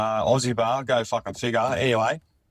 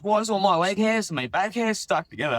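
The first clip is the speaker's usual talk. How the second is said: higher, puzzled mate voice; a lightly voiced confused mate waking up; mate complaining; 9 semitones higher than usual. Storyteller imitates his hungover mate, higher puzzled voice. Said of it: "he goes".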